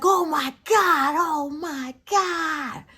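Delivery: high, loud, drawn-out imitation of excitement; excited outburst. Retelling her brother's reaction, excited outburst. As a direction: stretch it out, high and overwhelmed.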